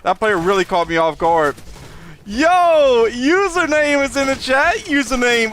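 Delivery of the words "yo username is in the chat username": high, bright-spirited shout-out; excited greeting. Real, not acted. Streamer welcoming a viewer into chat, excited. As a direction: hail a newcomer with a raised, eager voice.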